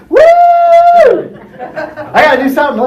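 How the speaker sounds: very high, loud, stretched-out hyped squeal; hyper excited. Acted. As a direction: burst with restless energy, laughing. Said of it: "the chaos the high I would create".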